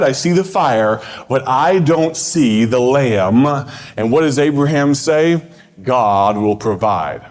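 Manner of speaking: high-pitched, projected sermon delivery with long pauses; preaching. Real, not acted. Preacher urging campers to let go of their plans, preaching. Preaches a recorded camp message.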